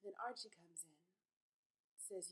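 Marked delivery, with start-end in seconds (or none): none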